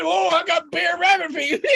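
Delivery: high yelping rabbit voice; cold Rabbit. Acted. Rabbit's feet freeze on ice, high yelping. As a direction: cry it out shivering and high.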